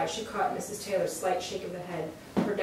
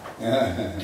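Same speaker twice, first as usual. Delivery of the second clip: tearful sob-laugh; 10 semitones lower, 4 dB louder than usual. A narrator's reading. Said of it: "clogged with tears".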